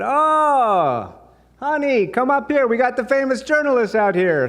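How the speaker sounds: put-on very high, loud, thrilled Israeli accent. Shopkeeper calling her husband to meet a journalist, excited Israeli accent. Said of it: "Israeli accent".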